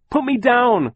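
high, loud panicked scream. Acted. Klaus held in the creature's mouth, screaming to be put down. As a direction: scream to be let go. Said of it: "Klaus screamed".

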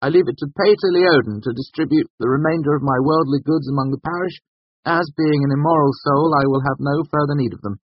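high, loud testament voice; loud dictation. A narrator's reading. Bast dictating his will, loudly. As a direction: declaim it as a solemn legal bequest. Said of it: "Bast said loudly across the room".

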